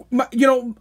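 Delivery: ordinary delivery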